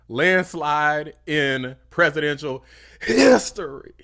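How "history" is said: a real burst of rising fervour; emphatic shout. Real, not acted. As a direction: punch it out higher and louder. Peak of wishing for a landslide.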